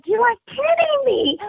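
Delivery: very high, squealing incredulity; stunned disbelief. Retold or imitated. Arrested by police she knows, very high stunned disbelief. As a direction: pitch shoots up in disbelief.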